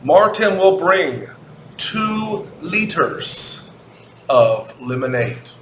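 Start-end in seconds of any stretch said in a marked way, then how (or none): 1.8-3.7 two liters drawn out slowly, high; stretched answer. Shown as a demonstration. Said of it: "I'll help you".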